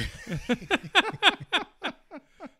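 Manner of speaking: loud high burst of laughter; big laugh. Real, not acted. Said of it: "I just love it when he does that".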